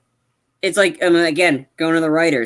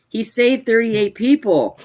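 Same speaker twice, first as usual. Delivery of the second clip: "saved thirty-eight people" slow, high and insistent; emphatic repeat; 4 semitones higher, slower than usual. Real, not acted. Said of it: "I wanna keep emphasizing".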